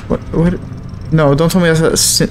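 usual speaking voice

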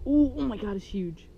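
thrilled gasp of delight; excited. Real, not acted. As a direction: blurt it out, amazed. Big fish hooked, exclaiming.

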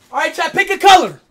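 loud call to the chat. Real, not acted. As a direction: shout it out to the viewers. Calling out to stream chat.

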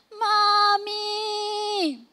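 put-on high, wailing child's cry for mommy, first try. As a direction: cry out like a hurt little boy calling his mother. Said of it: "tas sigaw siya". (mataas na iyak ng batang tumatawag sa nanay)

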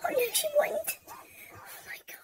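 voiceless, fading whisper; barely audible lament; tearful whisper. Real, not acted. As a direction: let it fade to a whisper, overcome. Overcome mother, voice drops away.

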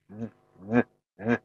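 "Z" with vocal cords vibrating, each one separate; voiced buzz. Shown as a demonstration. Teacher contrasts it with the breathed sound, voiced buzzing "Z". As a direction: buzz each "Z" softly in the throat. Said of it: "这个要声带有震动的".

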